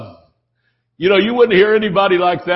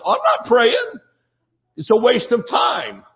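high-pitched mock indignation; indignant refusal; 7 semitones higher than usual. Acted refusal of prayer request.

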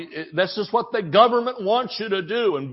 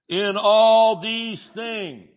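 slow emphatic reading; 4 semitones higher, 5 dB louder, much slower than usual. Shown as a demonstration. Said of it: "You need to learn nay".

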